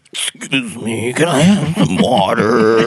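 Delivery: slow, eerie patient voice; creepy zombie voice. Retold or imitated. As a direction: drag it out like a zombie. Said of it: "her voice was almost exactly like that".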